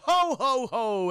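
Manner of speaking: jolly mock belly-laugh call; Santa Claus. Streamer imitating the film's Santa for chat, jolly. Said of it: "voiced by Ed Eisner".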